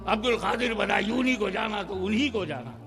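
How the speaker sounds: ordinary talk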